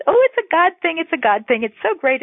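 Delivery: high-pitched mock gushing; gushing believer. Wife parodying her God talk beside atheist husband, gushing. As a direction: gush in playful self-parody. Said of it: "I am one of these".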